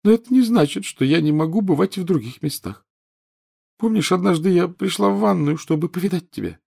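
put-on high-pitched sulky ghost girl; a reproachful little ghost girl; whiny ghost girl. Myrtle voiced by narrator.

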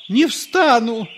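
very high, loud refusal; kneeling priest refusing to stand; stubborn priest. A narrator's reading. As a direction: dig in and refuse. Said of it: "повторил отец Фёдор".

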